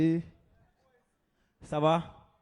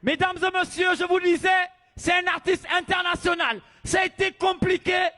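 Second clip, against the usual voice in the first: loud, high stage introduction; announcer voice; 7 semitones higher, 7 dB louder than usual. Acted. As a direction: hype the crowd for the act. MC announcing to crowd.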